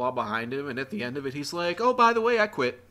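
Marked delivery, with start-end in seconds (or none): 1.8-2.8 casual mic drop. Retold or imitated. JT's mic drop quote.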